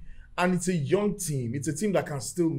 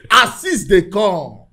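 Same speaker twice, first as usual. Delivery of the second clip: high, loud, exuberant Nigerian accent; 6 semitones higher, 13 dB louder, much slower than usual. Shown as a demonstration. Fan promising goals and assists, hammed-up Nigerian accent. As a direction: shout it gleefully in a Nigerian accent, stretching words out. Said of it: "let me speak in my Nigerian accent".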